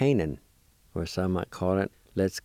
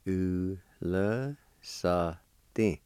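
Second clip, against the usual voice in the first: "Ule sa din" drawn out very slowly; syllable by syllable; much slower than usual. Shown as a demonstration. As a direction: break "Ule sa din" into separate, held syllables. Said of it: "It's pronounced like this".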